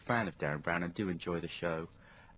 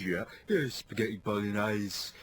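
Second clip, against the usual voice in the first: advert person; 4 semitones lower, slower than usual. Retold or imitated. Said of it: "then someone says".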